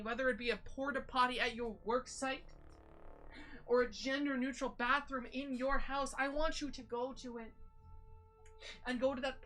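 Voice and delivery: ordinary delivery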